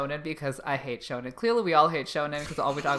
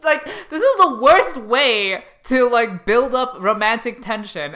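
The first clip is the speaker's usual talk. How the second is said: exasperated rant; 6 semitones higher, 6 dB louder than usual. Retold or imitated. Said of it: "And I'm like".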